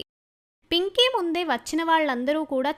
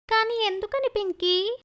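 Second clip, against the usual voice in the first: put-on higher girl's voice, inviting; cheerful older sister; 7 semitones higher than usual. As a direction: call your little sister out to play. Hurt sister asking why.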